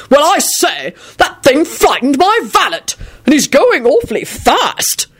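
put-on high, flustered old lord; fussy old gentleman. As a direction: fret like a posh old man. Old gentleman worried about corpse.

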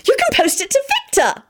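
very high, eager squeal; girlish high-pitched outburst; excited shriek. A narrator's reading. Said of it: "Elizabeth shrieked excitedly".

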